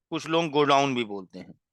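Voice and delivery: ordinary talk, nothing marked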